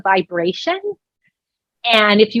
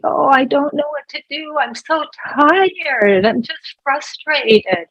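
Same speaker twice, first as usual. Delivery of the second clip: higher, slow, drawn-out lament; acted downhearted complaint, dragging and higher; sad and frustrated; 6 semitones higher, slower than usual. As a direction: stretch the words out in acted weary frustration. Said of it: "when we're sad".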